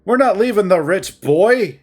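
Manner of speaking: loud, high and bitter; angry, tight delivery; hissing words. Acted. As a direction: spit the words out through pain. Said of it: "He practically hisses his words".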